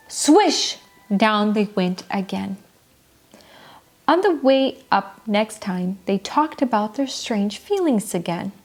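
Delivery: ordinary delivery, nothing marked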